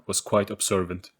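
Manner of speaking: plain reading voice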